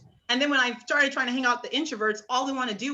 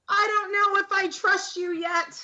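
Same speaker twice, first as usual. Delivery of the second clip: coy; 9 semitones higher than usual. Retold or imitated. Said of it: "you would still be like".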